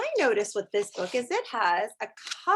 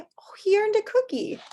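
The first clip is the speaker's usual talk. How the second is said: delighted; 11 semitones higher, 3 dB louder than usual. Real, not acted. Delighted reaction.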